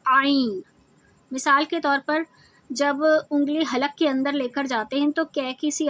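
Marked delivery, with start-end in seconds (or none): 0.1-0.6 "عین" pressed from the middle of the throat; throaty Ain. Shown as a demonstration. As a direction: force "عین" out through a tightened throat. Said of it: "زور لگاتے ہوئے". ("عین" حلق سے زور لگا کر)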